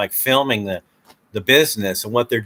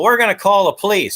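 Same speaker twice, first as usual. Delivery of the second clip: high, louder angry threat; mock threat; 9 semitones higher, 4 dB louder than usual. An angry owner threatening the cameraman with police. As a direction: threaten to call the cops. Owners finally get mad.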